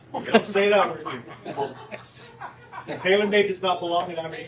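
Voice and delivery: loud, laughing mock scolding; laughing protest. Real, not acted. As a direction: object loudly through laughter. Mock ban on a word, laughing.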